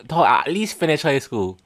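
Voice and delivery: ordinary talk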